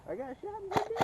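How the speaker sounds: high squeaky hurt voice; mock wounded squeal. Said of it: "when Hurt goes".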